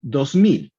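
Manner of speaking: ordinary delivery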